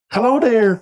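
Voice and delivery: lilting Irish greeting; Irish accent. Shown as a demonstration. A stream greeting done in an Irish accent.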